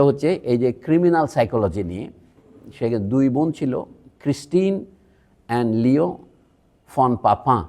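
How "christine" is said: ক্রিস্টিন said higher in French style; French name, lifted voice; French pronunciation. Shown as a demonstration. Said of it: "ওই যে এরকম উচ্চারণ".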